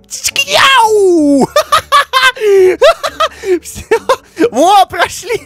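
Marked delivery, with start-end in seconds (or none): 0.1-1.5 very high, laughing kung fu yell; kung fu cry. Pretend. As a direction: screech it like a kung fu fighter. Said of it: "Видите, смотрите".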